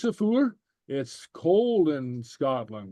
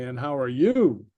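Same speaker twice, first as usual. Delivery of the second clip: "And how are you?" with the weight on "you", falling; emphasis by tone; 3 dB louder than usual. Shown as a demonstration. Teacher contrasts English with Gaelic, emphasizing "you" by tone. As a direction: lean on "you" to turn the question back. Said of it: "emphasize it by tone".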